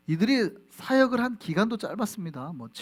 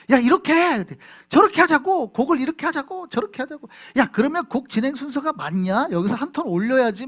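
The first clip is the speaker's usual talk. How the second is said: shouting higher and louder at a bandmate; heated quarrel; 6 semitones higher, 6 dB louder than usual. Retold or imitated. Young pastor re-enacts the praise team quarrel, loud angry shouting. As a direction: hammer each demand like a furious teen in a worship-team fight. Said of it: "막 이러고 이걸로 매주일 싸우니까".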